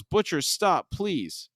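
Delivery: high, mild retold yell; understated yell, softening into pleading. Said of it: "Ryan yells".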